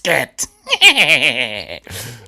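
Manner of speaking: loud shrieking ghoulish cackle. Pretend. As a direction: cackle like the Crypt Keeper. Horror host cackle.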